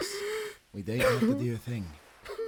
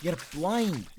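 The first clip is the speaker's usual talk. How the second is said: loud, high urgent warning; urgent calling; 7 semitones higher, 4 dB louder than usual. Acted. Sailor warning blind Simon on the rock. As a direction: call out to stop him falling.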